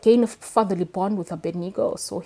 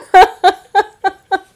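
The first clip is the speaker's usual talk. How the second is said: big high laugh; loud laugh; 13 semitones higher, 17 dB louder than usual. Real, not acted. Author laughing at liking a psychopath. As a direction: burst out laughing. Laughing at her own confession.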